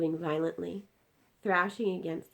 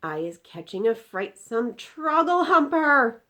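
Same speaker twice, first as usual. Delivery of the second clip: slow, loud, high giant's cry; 6 semitones higher, 12 dB louder, slower than usual. Acted. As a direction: shout out the horror. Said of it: "he cried".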